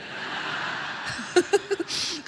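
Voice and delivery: long breathy giggle; laugh. Real, not acted. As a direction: let a long soft laugh run out. Laughs at her own testing joke. (tertawa geli panjang)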